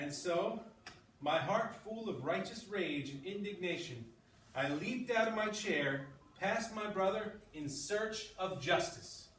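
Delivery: storyteller voice for a class read-aloud; lively read-aloud narration; story reading. Acted. Teacher reads story aloud.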